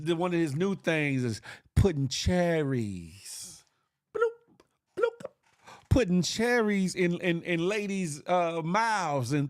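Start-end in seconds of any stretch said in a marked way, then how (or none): 4.1-5.3 high squeaky bloop noise; mock pop sound. Mimicking the cherry dropping into a mouth, squeaky pop noise.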